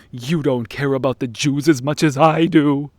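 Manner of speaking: mock-indignant opponent voice; mocking quote. Debater playing the moral trump card, mocking. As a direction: put on a sanctimonious accuser.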